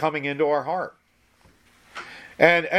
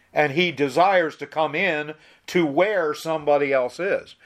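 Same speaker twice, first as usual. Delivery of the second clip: usual speaking voice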